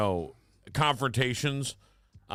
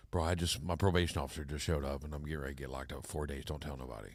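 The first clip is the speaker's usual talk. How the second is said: hushed secret; 10 semitones lower, 10 dB quieter, faster than usual. Retold or imitated. Son's secret told in hushed quote.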